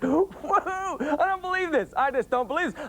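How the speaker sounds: high, slow, astonished whoa; amazed disbelief. Retold or imitated. Dad amazed that his daughter did chores unasked. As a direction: act floored by the news.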